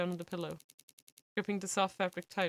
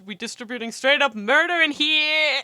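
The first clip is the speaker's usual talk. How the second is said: loud high exclaim; mock outraged shout; 6 semitones higher, 12 dB louder than usual. Real, not acted. Joking outrage.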